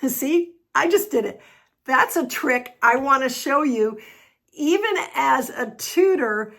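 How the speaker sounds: laugh, then own up brightly to the mistake; amused at own slip. Real, not acted. Tutor caught tripping over a word, laughing.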